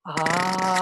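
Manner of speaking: long ah sinking from throat to stomach; voice moving downward. Shown as a demonstration. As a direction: hold an ah and send it down to the belly. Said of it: "imagine that your voice is following your hand".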